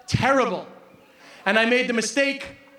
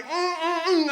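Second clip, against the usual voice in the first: savouring mm; 3 semitones higher, slower than usual. Real, not acted. Savouring the rabbit.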